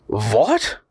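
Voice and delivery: mild cry of surprise, rising; understated indignant surprise; astonished cry. A narrator's reading. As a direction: cry it as a startled question. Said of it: "cried M. Fredrickson".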